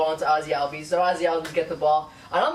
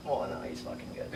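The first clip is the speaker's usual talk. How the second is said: breathy whisper, almost under the breath; whispered aside; 3 semitones lower, 11 dB quieter than usual. Acted. Fan whispers a grudging admission after ranting at Ozzie, hushed. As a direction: let it slip out as a hushed admission.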